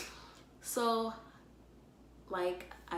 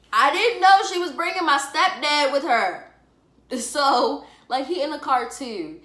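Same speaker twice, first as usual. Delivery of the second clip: excited; 6 semitones higher, 10 dB louder than usual. Real, not acted. Surprised stepdad came along.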